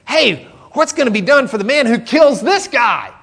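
high, loud, keen to know the prize; eager question. Retold or imitated. David asking the soldiers about the reward, eagerly. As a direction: ask it like a kid spotting a chance. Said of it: "David asks the men".

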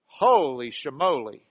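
"Holy" punched loud in "Holy shamoly"; stress on holy. Shown as a demonstration. Preacher playing the grumbler, stressing "Holy". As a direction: hit "Holy" hard. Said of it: "Emphasis on the word holy".

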